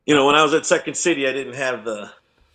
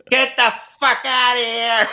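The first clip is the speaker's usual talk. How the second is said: bartender yelling; 9 semitones higher than usual. Retold or imitated. Bar lady turning from a customer to scream at the bum, high mock yell. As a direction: snap from sweet to furious. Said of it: "they'd be like".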